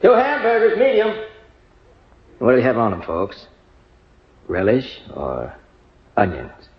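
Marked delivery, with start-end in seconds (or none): 0.0-1.1 high-pitched counterman's call; raised, carrying order call. Acted. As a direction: sing out the order to the kitchen. Counterman calls order to kitchen.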